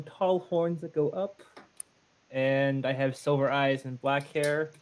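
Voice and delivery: ordinary talk, nothing marked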